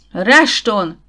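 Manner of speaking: loud, booming shout of a name; thundering call. A narrator's reading. Husband bellows at the armed villain. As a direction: thunder his name across the cave. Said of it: "mennydörgésé fokozták Gideon hangját".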